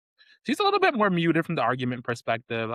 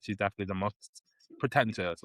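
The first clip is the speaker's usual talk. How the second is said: ordinary talk, nothing marked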